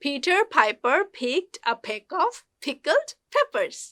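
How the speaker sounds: slow "Peter Piper" with puffed Ps; careful, spaced-out model of "picked a peck"; P sound drill. Shown as a demonstration. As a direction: pop each P in "pickled peppers", word by word. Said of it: "পি সাউন্ডটা উচ্চারণ".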